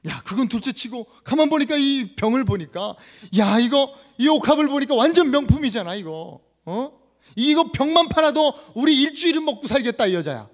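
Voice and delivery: loud, high acted rebuke; scolding disciple. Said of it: "막 이러면서 막 뭐라 그럽니다". (큰 소리로 나무라는 제자)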